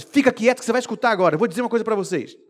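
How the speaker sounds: rápido e mais alto, autoritário; ordem firme. Acted. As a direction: manda calar de uma vez. Paulo manda calar. (ordem firme de Paulo para os ouvintes)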